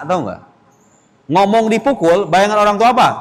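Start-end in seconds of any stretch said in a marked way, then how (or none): none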